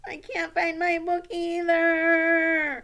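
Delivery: loud, high, drawn-out mock crying; hammed-up wail. Child who also lost a book, wailing in mock despair.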